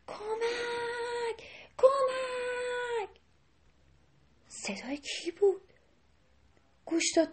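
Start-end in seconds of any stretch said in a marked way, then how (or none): 0.1-3.1 high, drawn-out call from far away; distant cry for help. Acted. Rabbit calling faintly for help from the river. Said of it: "میگفت کمک".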